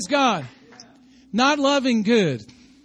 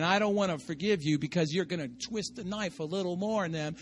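a hint of quiet spite; lightly voiced grudge, quieter; mean spirit; 5 semitones lower, 8 dB quieter than usual. Preacher voicing a spiteful heart to the congregation, mild spite. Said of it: "a mean spirit in you right there saying".

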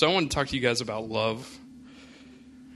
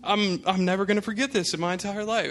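hammed-up horrified friend; 8 semitones higher than usual. Friend reacting to the news in exaggerated horrified guilt. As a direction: overplay a friend's panicked remorse.

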